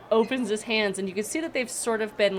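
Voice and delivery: ordinary talk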